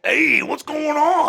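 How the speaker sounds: a laid-back surfer werewolf yelling hey; hyped werewolf bro; party werewolf dude. Acted. Werewolf stoner voice.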